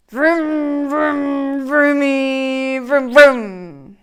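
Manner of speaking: loud playful engine vroom; vroom engine noises. Acted. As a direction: make car noises like a kid. Pigeon pretends to drive.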